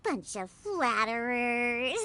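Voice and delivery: slow, bashful scoff; embarrassed mock scoff. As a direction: brush off the praise slowly. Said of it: "Diona is the best".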